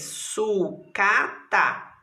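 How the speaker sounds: SUCATA slowly split as su, ca, ta; syllable by syllable. Shown as a demonstration. Teacher counting syllables for pupils, syllable by syllable. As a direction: break "Sucata" into three drawn-out beats. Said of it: "Quantas sílabas".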